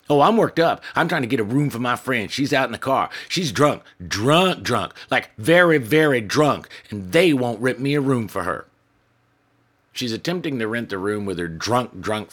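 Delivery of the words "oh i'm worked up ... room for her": much higher and louder, rushing agitation; worked up. Acted. Woman in a tight dress pleading for her drunk friend's room. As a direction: pour it out all in a fluster. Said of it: "worked up about something".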